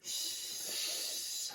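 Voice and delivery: soft unvoiced shush; quiet the room; hushing. Real, not acted. Hushing the children.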